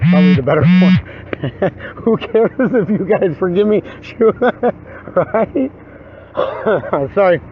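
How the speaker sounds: laughing as the words come out. Real, not acted. Man jokes about whose forgiveness matters, laughing. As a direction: talk through laughter. Laughing at his own apology.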